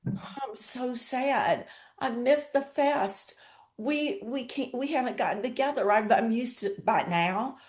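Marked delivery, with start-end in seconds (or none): 0.1-5.9 mock sad lament. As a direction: imitate disappointed fans moaning about missing the fest. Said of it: "the people that I talk to that say".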